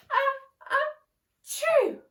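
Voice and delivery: high, loud building sneeze ah ah choo; hammed-up giant bear sneeze; big sneeze. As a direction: wind up and explode into a sneeze. Bear's huge sneeze.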